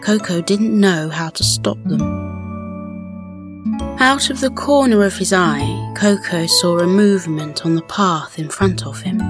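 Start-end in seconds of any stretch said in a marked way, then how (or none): none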